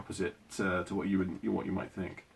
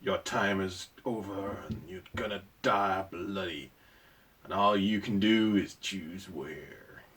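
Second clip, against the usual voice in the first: fake cowboy accent. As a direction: put on a hammy Western accent. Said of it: "That is my terrible cowboy accent".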